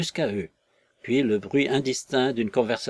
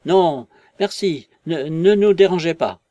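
oddly jerky voice; 5 semitones higher, 8 dB louder than usual. Acted. The vicar answers Hall through the door, halting and jerky. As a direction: stumble over the words as if under pressure. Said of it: "d'une voix curieusement saccadée".

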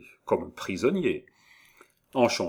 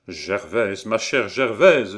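imploring call, building louder and slower; ardent cry; 6 dB louder, slower than usual. A narrator's reading. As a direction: call out to the beloved. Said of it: "s'écria Jacques Aubry". (appel passionné)

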